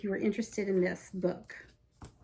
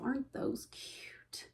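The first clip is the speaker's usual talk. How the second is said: whisper; 2 semitones higher, 10 dB quieter, slower than usual. Real, not acted. Gushing aside over cute pattern.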